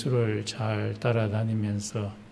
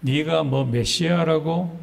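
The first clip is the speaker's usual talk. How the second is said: higher, louder retold jeer; mocking crowd; 5 semitones higher, 5 dB louder than usual. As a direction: lightly act out a scoffing challenge. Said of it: "예수를 막 조롱하기 시작했습니다". (조롱하는 군중을 흉내 내듯 높고 크게)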